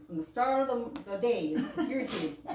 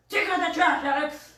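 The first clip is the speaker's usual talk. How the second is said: louder, higher, bossy command; barked order; 5 semitones higher, 8 dB louder than usual. Shown as a demonstration. Family member ordering Alex to take out the trash, barked. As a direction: bark it like an order. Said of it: "it's like a order".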